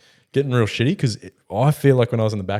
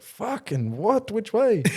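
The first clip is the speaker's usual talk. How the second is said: high, very slow baffled mock panic; sudden confusion; 11 semitones higher, much slower than usual. Driver behind the wheel forgets the way, high slow baffled. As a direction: stretch it out, suddenly clueless.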